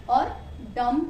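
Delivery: usual speaking voice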